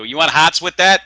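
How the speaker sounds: loud, high Boston counterman voice; Boston deli guy. Retold or imitated. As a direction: bark it like a Boston sandwich guy. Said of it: "do that again".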